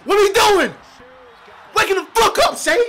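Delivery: high, loud shout at the screen; furious yell. Real, not acted. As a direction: scream at the player to wake up. Yelling at player on screen.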